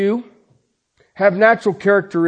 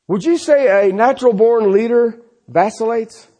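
usual speaking voice

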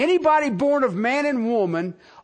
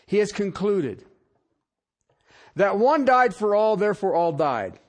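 ordinary delivery

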